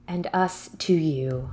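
hushed, slow ceremonial whisper; solemn whisper. Pretend. Host answering a listener's love in a solemn whisper. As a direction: whisper it like an ancient vow. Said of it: "What the hell? Is that a Star Wars reference?".

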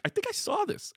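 put-on confused girlfriend. Mocking his partner rewatching old episodes, high and unsure. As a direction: act her half-remembering a scene. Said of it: "So that she's like".

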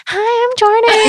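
put-on, very high, people-pleasing hello; high-pitched nervous greeting. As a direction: greet someone in an exaggerated squeaky-high voice. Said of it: "speak in a really high-pitched voice".

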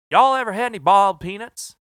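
loud, high Southern drawl, mimicking the sales rep; hammed-up Southern accent calling out an offer; Southern rep pitching his favourite snack in a drawl. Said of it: "he's got that southern drawl".

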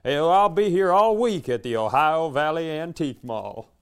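mock stage patter. Singer to the mall crowd, mock stage patter. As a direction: plug the gig like a lounge act. Performer announcing a residency from back.

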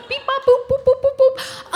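high, far-off computer beeping; robot beeps. Pretend. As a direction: beep like a scanning robot. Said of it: "check in real fast".

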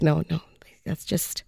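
breathy whisper. Retold or imitated. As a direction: hush it conspiratorially. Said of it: "She's like".

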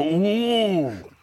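high, drawn-out "ooh"; rising then falling mock-sexy moan; imitated push moan. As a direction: coo as if impressed by the push. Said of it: "then he'll push and go".

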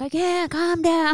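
high, slow, strained plea; panicked whine. Retold or imitated. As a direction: whine it like you're spiralling. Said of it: "And I'm like".

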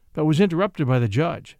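ordinary delivery, nothing marked

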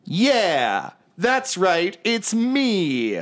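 a show-off waiting to be recognized; vain bard. Acted. Vain bard announces himself to an old ally, preening. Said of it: "he goes".

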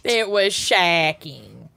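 slow, hammed-up Minnesotan accent. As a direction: stretch it out like a Minnesotan. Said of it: "their worst Minnesotan accents".

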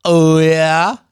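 loud drawn-out oh, rising at the end; acted-out intrigued comeback; questioning reply. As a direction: stretch it into a question. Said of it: "my response was".

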